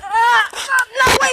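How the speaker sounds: high loud startled protest; panicked yelp. Real, not acted. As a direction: yelp and plead. Shot again, protesting.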